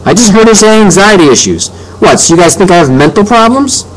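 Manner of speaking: high-pitched, outraged accusation; indignant. A narrator's reading. Boy confronting both parents, indignant.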